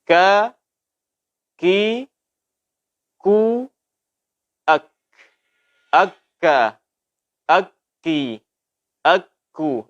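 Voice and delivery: gentle ka ki ku, akka akki akku; soft release each syllable; soft kaf. Shown as a demonstration. Teacher repeating the correct kaf drill. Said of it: "Ini cara pengucapan daripada huruf kaf".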